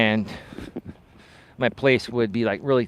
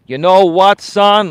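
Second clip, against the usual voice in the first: loud, slow Greek dad impression; thick Greek accent; 7 semitones higher, 10 dB louder, slower than usual. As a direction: imitate a Greek father, high and drawn out. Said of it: "with his thick Greek accent".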